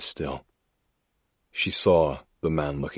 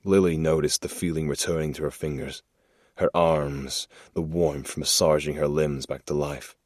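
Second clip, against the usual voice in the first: ordinary delivery, nothing marked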